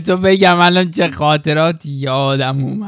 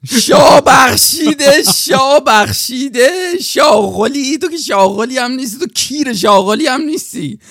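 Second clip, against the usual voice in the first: mocking clerk; 6 semitones higher than usual. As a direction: sneer at him like the rude clerk. (کارمند تحقیرکننده با صدای زیر و بلند)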